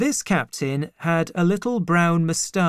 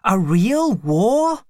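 slow, higher, louder thrilled question; excited cry; 6 semitones higher, 5 dB louder, slower than usual. A narrator's reading. Servant boy asks if war is real, slow excited question. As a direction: savour each word, delighted by the idea. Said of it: "he cried excitedly".

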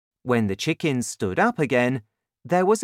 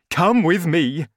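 loud, higher-pitched command; raised, urgent father's voice; excited father; 5 semitones higher, 6 dB louder than usual. A narrator's reading. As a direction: order the boy along eagerly. Excited father orders son along.

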